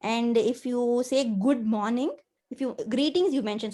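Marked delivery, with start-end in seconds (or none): none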